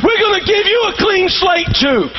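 rising sermon call; building preaching. Acted.

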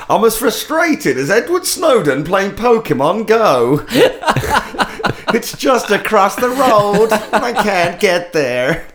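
mock South African accent, slowed, with laughter; lightly hammed South African lament. Joking on the podcast about Snowden, in a South African accent. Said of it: "It's a good South African accent".

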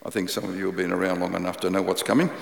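ordinary talk, nothing marked

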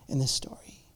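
hushed, unvoiced aside; sudden whisper. Pastor leaning in to the congregation, whispering. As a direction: pull them in with a whisper. Drops to hush after shouting.

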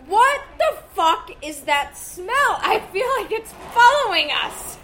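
loud, high, slowly stretched exclamation; mock disgusted outcry. Boyfriend complains to her about a smell, mock appalled. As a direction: act out a loudly appalled boyfriend.

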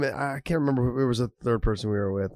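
usual speaking voice

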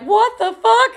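high, loud shocked exclamation; shocked shout. Retold or imitated. Old bartender spotting her walk into the bar, loud high shock. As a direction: yell it in disbelief.

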